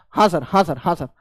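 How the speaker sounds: low, soft yes-sir replies; meek agreeing student. Acted. Students answering the teacher's yes or no, meekly agreeing. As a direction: agree meekly, dropping pitch and volume.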